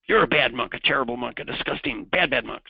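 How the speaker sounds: loud, mock-angry scolding; angry monk. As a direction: scold like an outraged monk in a retold tale. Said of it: "The other monk is angry".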